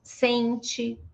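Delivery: "Sente" as SEN-chee; weak final I. Shown as a demonstration. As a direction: say "Sente" with a faint ee ending. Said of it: "ele fica mais fraco".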